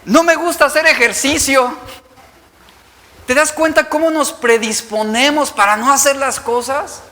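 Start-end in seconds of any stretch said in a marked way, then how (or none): 0.0-1.8 much higher, whining excuse to the doctor; whiny excuse. Retold or imitated. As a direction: plead your way out of exercise. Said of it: "Y tú le respondes al doctor". (excusa quejumbrosa al doctor, aguda)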